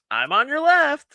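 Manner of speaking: high, loud, drawn-out declaration; mock insistent claim. Girl barging in to claim the kiss, mock insistent.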